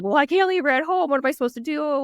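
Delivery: high, loud mock wail; retold bawling. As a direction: act out bawling helplessly to a friend. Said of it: "I'm bawling to her".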